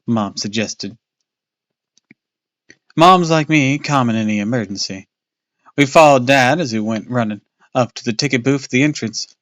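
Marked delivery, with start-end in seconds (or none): none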